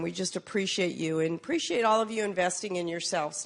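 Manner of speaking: normal talking voice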